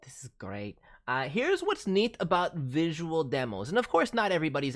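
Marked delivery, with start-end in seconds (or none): 0.0-0.8 soft unvoiced whisper of delight. Real, not acted. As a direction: whisper it in admiration.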